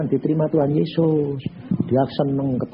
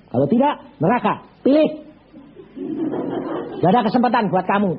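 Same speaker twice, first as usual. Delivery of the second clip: stern warning; 6 semitones higher than usual. Retold or imitated. Pastor warns dying banker.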